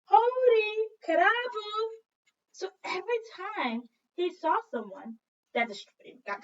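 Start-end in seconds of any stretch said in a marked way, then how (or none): 0.0-1.9 high, slow, loud ringing greeting; cheerful boy calling hello; boy's greeting. Retold or imitated. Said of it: "Look, his grandmother".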